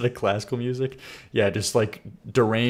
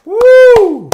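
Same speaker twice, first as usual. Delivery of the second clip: loud high whoop of hype; full-throated high cheer; excited whoop; 18 dB louder than usual. Real, not acted. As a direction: let out a big celebratory woo. Hype whoop after a track.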